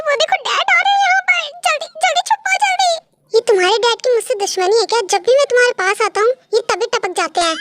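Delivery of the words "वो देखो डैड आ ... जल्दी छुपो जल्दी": high, urgent hurrying; panicked warning. Acted. Girl telling boyfriend Dad is coming, panicked warning. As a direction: frantically push them to hide.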